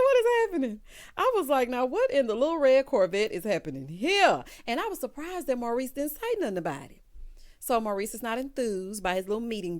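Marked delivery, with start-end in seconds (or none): 0.0-4.4 loud, high, incredulous; amused disbelief. Retold or imitated. Reacting to Calvin in a blouse, loud amused shock. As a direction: exclaim it high, punching each word.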